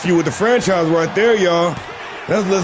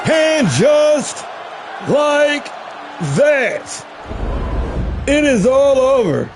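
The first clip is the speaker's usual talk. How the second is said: finish call; 7 semitones higher, much slower than usual. Acted. Commentator calling the match ending, dramatic excited call. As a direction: stretch each word to the final bell.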